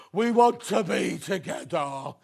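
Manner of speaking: slow, loud Brummie catchphrase; a touch of Brummie, drawn out and happy; slow happy Brummie advert line; Brummie accent. Retold or imitated. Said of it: "With a Brummie accent".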